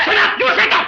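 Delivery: high-pitched angry yell; exasperated outburst. Acted. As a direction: explode to shut him up. Exasperated boss silences clerk. (எரிச்சலில் கீச்சிடும் கத்தல்)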